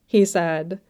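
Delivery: ordinary delivery, nothing marked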